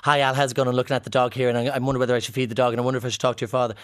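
very fast chipmunk-quick gabble; double speed voice note. Retold or imitated. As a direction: rattle it off like a sped-up recording. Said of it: "a voice note that says".

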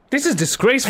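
high-pitched, put-out complaint; indignant protest. Real, not acted. Losing host complains about co-host's victory, indignant. Sore loser protests the result.

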